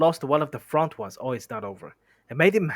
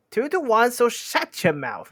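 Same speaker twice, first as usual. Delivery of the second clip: high, loud squeaky troll; squeaky, bossy troll; Bert's squeaky voice; 10 semitones higher, 5 dB louder than usual. Acted. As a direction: shut him up in a raised squeak. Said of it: "the squeaky voice".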